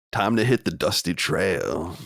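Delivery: slow cowboy line, lightly twanged; mosey into it like a trail hand; cowpoke voice. Acted. Host joining the Southern Man joke, slow cowpoke twang.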